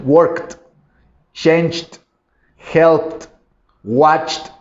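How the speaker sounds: worked, changed, helped, watched ending in T; crisp T on each ending; hard -ed as T. Shown as a demonstration. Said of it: "Todos esos sonidos fuertes".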